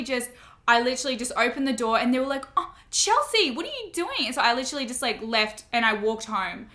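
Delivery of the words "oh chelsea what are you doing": high, startled call after a student leaving; teacher catching a student walking out, calling her back; surprised teacher calling out. Retold or imitated. Teacher calls after Chelsea as she storms out, high and startled.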